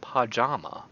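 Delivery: "pajama" as PAH-JAH-ma, both open ah; wrong vowels. Shown as a demonstration. Rejecting a pronunciation he never uses, "pajama" as PAH-JAH-ma. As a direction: say "pajama" with ah in both first syllables. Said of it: "I don't say".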